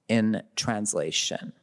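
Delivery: usual speaking voice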